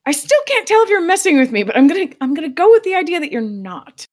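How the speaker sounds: high, loud flustered reply; high loud delighted reply; giddy disbelief. Retold or imitated. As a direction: gush it up high, half laughing. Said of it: "I was like".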